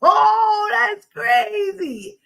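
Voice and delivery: loud high slow exclaim; surprised shout. Real, not acted. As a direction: shout it in disbelief.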